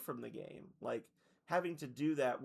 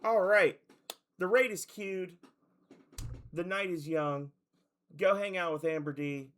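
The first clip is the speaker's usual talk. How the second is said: loud upbeat sign-off; 3 semitones higher, 6 dB louder than usual. Real, not acted. Energetic raid sign-off.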